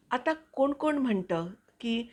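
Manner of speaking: voice-over reading. Acted. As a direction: read it like an expressive voice-over. Reads her own voice-over piece. (उत्साही voice over वाचन)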